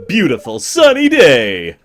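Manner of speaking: loud, stretched-out sunny proclamation; hammed-up weather presenter; TV forecast announcer. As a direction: belt out the forecast slowly.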